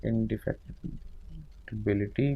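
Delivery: indefatigability softly stretched out syllable by syllable; murmured spelling out. Shown as a demonstration. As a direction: slowly murmur indefatigability while writing. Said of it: "murmured slow while writing".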